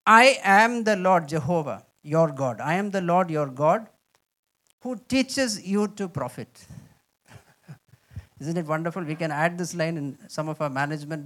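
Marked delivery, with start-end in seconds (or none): 0.1-6.7 loud, slow, majestic reading; voice of the Lord. Shown as a demonstration. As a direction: speak as God declaring himself. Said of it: "Let me read this".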